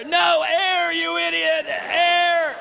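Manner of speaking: high strained plea for breath; hammed-up airless wail; wind knocked out. Winded man refusing water from friends, high strained gasp. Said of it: "Did you get the wind knocked out of you?".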